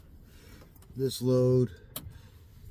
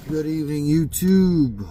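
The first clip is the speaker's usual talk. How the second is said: bright loud hello to the channel; loud greeting; 6 semitones higher, 10 dB louder, slower than usual. Real, not acted. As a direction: call out the greeting loudly. Vlog greeting to YouTube.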